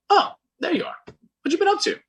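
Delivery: breezy friend finding him; mock friend voice.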